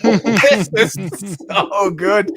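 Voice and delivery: high, loud words through real laughter; laughing. Real, not acted. Cracking up at the coast guard joke, real laughter.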